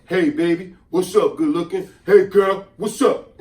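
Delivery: mock flirty catcall, loud and laughing; hammed-up pickup line, tossed out with a grin. Narrator mocks a flirty line he never said to the clerk. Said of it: "I never said".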